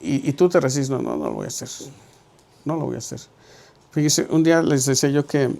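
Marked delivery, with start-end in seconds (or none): 0.9-3.2 stubborn refusal. Retold or imitated. Believer refusing what God asks, low grumble. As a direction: lower and softer, a grumbling no. Resisting God's command.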